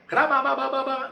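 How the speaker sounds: high, loud mock tongues babble; imitated speaking in tongues. Preacher mimics believers speaking in tongues, high babbling chant. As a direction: rattle off babbled syllables on one high note. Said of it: "comenzaron a hablar en lengua".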